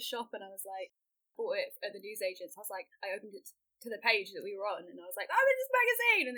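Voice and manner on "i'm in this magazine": much higher, louder, giddy; finding herself in the magazine, squealing with delight; thrilled. Retold or imitated. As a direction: squeal it like you can't believe it. Excited at newsagents over magazine.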